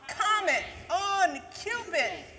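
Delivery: loud, high calling out names; calling the reindeer. Acted. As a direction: call out the reindeer like Santa urging them on. Santa calling his reindeer.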